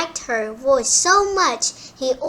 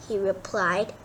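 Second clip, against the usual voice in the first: ordinary delivery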